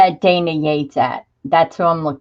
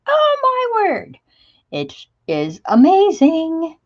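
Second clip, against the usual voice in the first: much higher and slow, thrilled; 11 semitones higher, slower than usual. Real, not acted. Host thrilled by the morning's new kit, high and slow. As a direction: swoon over the new release.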